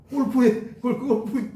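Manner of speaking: cracking up mid-story; laughing. Real, not acted. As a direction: try to speak through real laughter. Preacher cracks up retelling golf gift.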